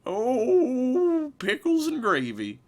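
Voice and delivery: put-on high, wavering old man voice. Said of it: "where my old man voice comes from".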